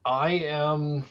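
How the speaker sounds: ordinary delivery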